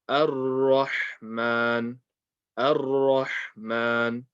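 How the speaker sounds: very slow "Ar-Rahman", light a then heavy rolled ra; light alif. Shown as a demonstration. Teacher repeating the correct model, light alif. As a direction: draw out "Ar-Rahman", opening on a light a. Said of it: "the alif needs to be light".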